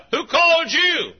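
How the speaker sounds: high, slow, loud challenge; suspicious holler. Retold or imitated. Man under the car challenges the mechanic, hollering. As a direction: holler it out, questioning the visitor.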